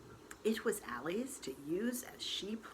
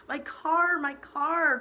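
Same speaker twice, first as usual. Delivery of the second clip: high, loud panicked cry; 11 semitones higher, 12 dB louder, slower than usual. Acted. Jogger girl cries out over her wrecked car, high and loud. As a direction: shriek it like your car is being wrecked. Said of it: "cried the jogger".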